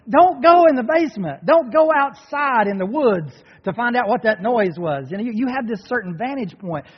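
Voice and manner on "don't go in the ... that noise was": loud, raised-pitch plea to movie characters; shouted warning. Acted. Moviegoer warning characters on screen, loud acted shout. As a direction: yell it at the screen, urgently.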